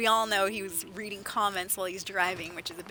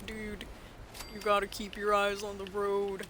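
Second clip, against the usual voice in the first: flat, unchanging monotone voice; no inflection at all, deadpan; 4 dB quieter than usual. Retold or imitated. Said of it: "He's this monotone type dude".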